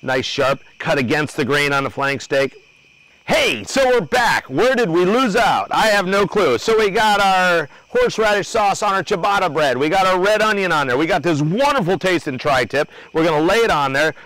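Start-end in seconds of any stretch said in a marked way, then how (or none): 3.2-14.2 high, punchy presenter voice; raised-pitch welcome-back patter; show host relaunch. Real, not acted. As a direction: burst back in like an excited TV host.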